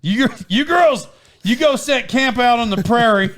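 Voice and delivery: lightly hammed-up frontier dad; mock frontier send-off. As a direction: send them off like a pioneer, jokingly. Playing frontier father to girls.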